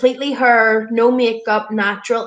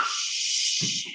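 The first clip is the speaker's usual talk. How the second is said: ordinary delivery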